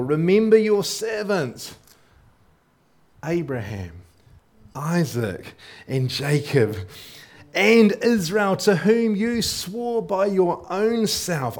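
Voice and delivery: slow, high, urgent plea; pleading Moses. Acted. Preacher voicing Moses pleading with God. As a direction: beg God to remember the fathers.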